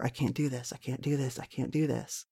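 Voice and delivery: a soft, defeated loop of the same words; repeating in despair. Retold or imitated. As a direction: murmur it over and over, barely audible. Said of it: "I just kept repeating over and over".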